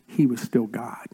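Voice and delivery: hushed whisper for weight. Real, not acted. Pastor whispering that God is still God. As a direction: let it fall to a whisper.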